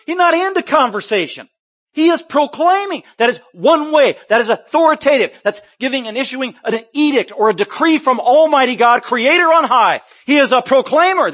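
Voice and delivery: rising fervent sermon declaration; full force preaching. Acted. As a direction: thunder it from the pulpit.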